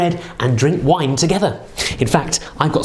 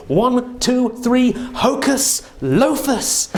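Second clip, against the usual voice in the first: big showy magic incantation; kids party magician; 7 semitones higher, much slower than usual. Pretend. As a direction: cast the spell like a stage magician. Magic words countdown for kids.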